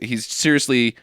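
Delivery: normal talking voice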